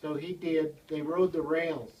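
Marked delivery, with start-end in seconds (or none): none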